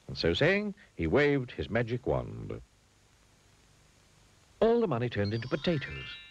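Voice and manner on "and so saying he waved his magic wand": ordinary delivery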